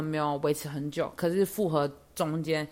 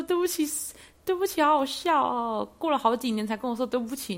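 一边笑一边高声调侃; 笑到不行地调侃他的道歉; 笑着说; 8 semitones higher than usual. Retold or imitated. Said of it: "我就一直笑". (边笑边高声开玩笑)

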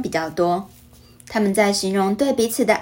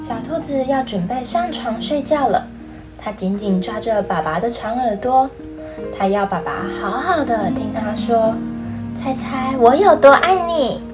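put-on reading to a toddler; 2 semitones higher than usual. Storytelling narration with character voices.

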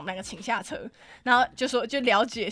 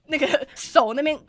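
normal talking voice

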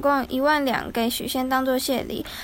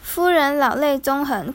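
ordinary delivery

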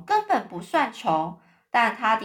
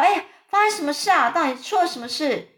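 raised, hurried questioning; alarmed loud question; 6 semitones higher, 3 dB louder, faster than usual. A narrator's reading. James jumps from bed asking what happened, loudly. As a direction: call out as if startled awake. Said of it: "大声说".

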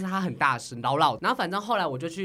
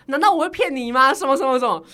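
imitating a clerk snapping back, louder and higher; mock angry clerk; 6 semitones higher, 10 dB louder than usual. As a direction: play the rude receptionist yelling back. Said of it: "他就说". (模仿柜台姐姐大声顶回来)

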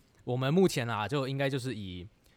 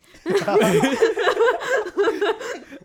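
laughing; 12 dB louder than usual. Real, not acted. Teased about a cheesy phrase.

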